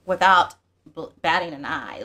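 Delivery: usual speaking voice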